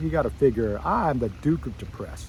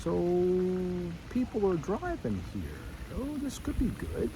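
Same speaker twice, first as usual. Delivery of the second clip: dawning realization; 6 semitones higher, 8 dB quieter than usual. Retold or imitated. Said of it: "And I went".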